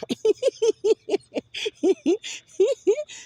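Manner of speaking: a sudden high burst of laughter. Real, not acted. Vlogger laughing to her viewers about her sister, high laugh.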